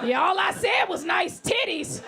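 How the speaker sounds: high, loud, aggrieved laughing protest; whiny harasser. Retold or imitated. Comic mimics a harasser defending himself, loud whine. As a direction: play the offended creep. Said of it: "those are the men that usually sexually harass".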